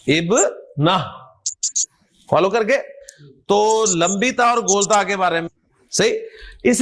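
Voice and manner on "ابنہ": ابنہ very slow, split as ib... nah; slow teaching take of ابنہ, h at the close; final h, no t. Shown as a demonstration. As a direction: break ابنہ in two and end on h. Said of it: "ہا کی آواز نکالنی ہے".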